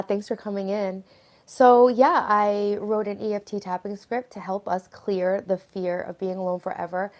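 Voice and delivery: normal talking voice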